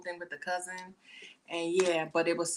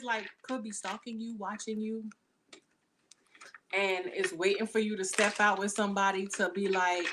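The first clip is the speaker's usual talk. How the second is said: ordinary talk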